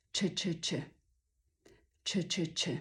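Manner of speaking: very light, soft, quick "czy, czy, czy"; barely touch each "czy", low and soft. Shown as a demonstration. Teacher contrasts it with juicy Polish czy, light and quick. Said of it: "bardzo lekkie i szybkie".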